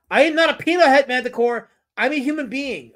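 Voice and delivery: high, loud wounded protest; mock indignation. Streamer objects to being called a peanut head, mock-indignant. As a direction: object with hammed-up offended dignity.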